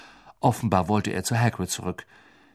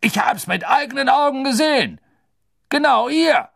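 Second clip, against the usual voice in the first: higher, louder enraged insistence; furious shout; 7 dB louder than usual. Acted. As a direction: shout it, livid.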